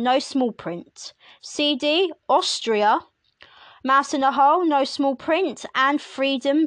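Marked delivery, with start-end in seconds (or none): none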